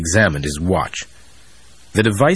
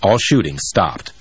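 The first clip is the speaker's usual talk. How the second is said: plain reading voice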